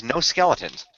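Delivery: usual speaking voice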